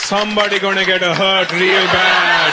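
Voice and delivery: dad's threat. Retold or imitated. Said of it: "That was my dad's threat".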